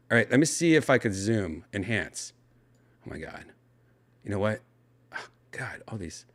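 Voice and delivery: usual speaking voice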